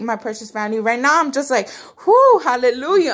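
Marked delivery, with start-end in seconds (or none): 2.1-3.1 a high, loud whoop of joy, real; joyful excited cry. Real, not acted. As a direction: burst out in praise, overjoyed. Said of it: "I'm just so excited right now".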